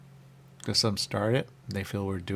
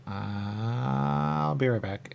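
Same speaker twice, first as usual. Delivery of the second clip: drawn-out excuse; 4 semitones higher, much slower than usual. Retold or imitated. To the pretzel stand, a slow, stretched fake promise. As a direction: stretch each word out, slow and high. Polite exit from sample.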